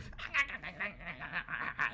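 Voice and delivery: loud, rattling put-on cackle; hammed-up villain laugh; manic shark cackle. Voicing the crazy shark.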